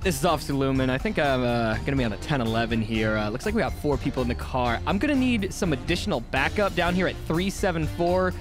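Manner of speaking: low, steady dispatch radio voice; calling it in like a cop on the radio; police radio call. Acted.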